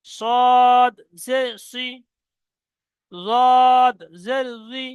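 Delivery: صاد and ضاد said slowly and loudly in Arabic; Arabic letter names. Shown as a demonstration. Teacher sounding Arabic letters for students, slow and loud. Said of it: "পড়ে দিলাম".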